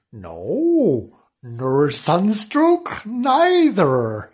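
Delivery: slow, swooping comic character voice; mad Mister Wonks. Acted. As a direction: stretch every word like a cheerful madman.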